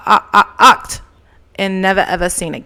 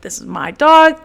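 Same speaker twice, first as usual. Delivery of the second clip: angry claim; 6 semitones higher, 6 dB louder than usual. Retold or imitated. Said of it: "I'm like".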